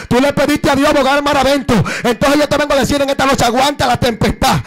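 loud, tearful preaching cry; full-force preaching. Acted. Pastor urging the congregation, loud tearful preaching. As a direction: preach it out to the whole church.

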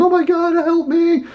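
high, hammed-up shriek of panic; mock panicked scream. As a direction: shriek it like a terrified passer-by, tongue in cheek.

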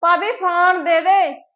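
slow, loud, stretched plea; drawn-out demand. Acted. Begging sister-in-law for phone. (ਲਮਕਾ ਕੇ ਉੱਚੀ ਮੰਗ)

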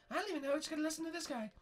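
high falsetto princess; Princess Peach. Pretend. Peach answers Bart.

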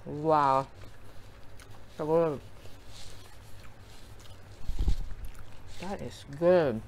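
talking between bites; speaking while eating food; mouth full, chewing. Real, not acted. Seen 1: pursed, bulging lips and chewing motion throughout; potato skins on tray.

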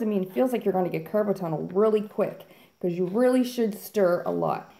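ordinary talk, nothing marked

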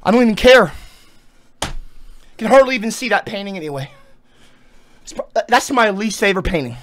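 loud, defiant ranting; loud angry rant. Real, not acted. Telling chat off after losing the painting, loud angry rant. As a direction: bark it at them out of spite.

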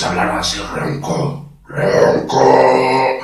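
rasped hoarse demo. Shown as a demonstration. Showing viewers the second step, hoarse. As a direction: roughen the voice, stretching the word out. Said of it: "hablar un poco ronco".

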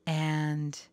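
ordinary talk

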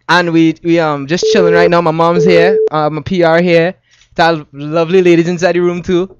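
easygoing Trinidadian lilt; casual chat in a Trinidadian accent. Shown as a demonstration. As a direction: speak with your Trinidadian accent. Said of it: "Drop some words with that accent".

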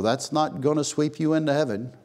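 ordinary talk, nothing marked

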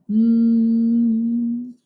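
long, steady humming; fly hum. Shown as a demonstration. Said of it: "flies hum".

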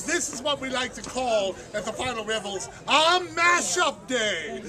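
normal talking voice

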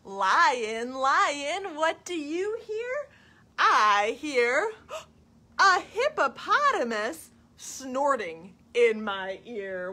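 high, loud sing-song animal call; reading to children. Acted. Storytime question and answer about a hippo, lilting and dramatic. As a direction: chant the question brightly upward, then stretch the answer. Reads picture book to children.

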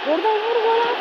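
high loud shout to shoot; cheering the shot on; urging shout. Real, not acted. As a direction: yell at the player to score.

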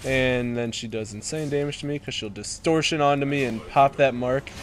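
normal talking voice

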